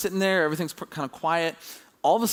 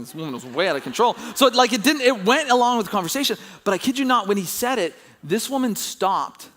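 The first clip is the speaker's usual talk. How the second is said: usual speaking voice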